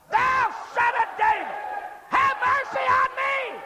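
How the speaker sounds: very high, hollered plea; desperate cry. Shown as a demonstration. Preacher rousing the church again, desperate holler. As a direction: belt it out as a desperate prayer. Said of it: "Let's hear you say that again".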